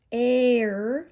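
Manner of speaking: "air" held long, aaayrr; teacher isolating the "air" sound slowly; stretched long A. Shown as a demonstration. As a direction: draw out the vowel of "air" into the R. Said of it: "I hear the long A".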